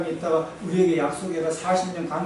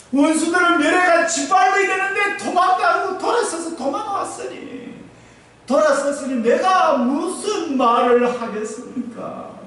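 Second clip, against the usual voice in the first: high, loud anguished prayer; pleading shout; 9 semitones higher, 7 dB louder than usual. Retold or imitated. Joshua pleading with God over the rout, high and loud. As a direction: wail the complaint up to heaven.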